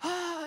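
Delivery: high imitated wail of a sobbing man; weeping cry. Man weeping in the car, high imitated wail. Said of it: "He's like".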